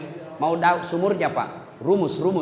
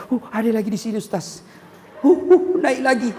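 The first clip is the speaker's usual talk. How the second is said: mild pregnant groans; 2 semitones higher than usual. Retold or imitated. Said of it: "dia bilang".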